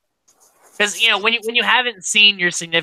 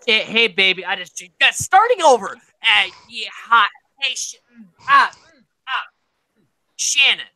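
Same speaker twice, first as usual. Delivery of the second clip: higher, fumbling, tongue-tied message; flustered stammering; 4 semitones higher than usual. Retold or imitated. Will trying to record a message for Shannon, flustered stammer. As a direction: stumble over every word like you forgot how to talk. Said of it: "he's getting frustrated".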